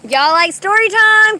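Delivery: high, slow sing-song tease; sing-song teasing. Real, not acted. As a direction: dangle story time playfully. Teasing the audience.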